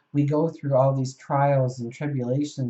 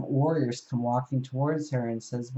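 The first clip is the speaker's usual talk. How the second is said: usual speaking voice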